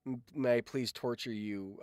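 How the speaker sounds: ordinary talk, nothing marked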